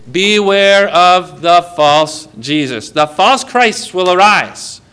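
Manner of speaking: slow, drawn-out pulpit warning; emphatic opening, then slow, weighty quotation; solemn warning. Acted. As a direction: stretch each word out like a proclamation. Preacher's solemn scriptural warning.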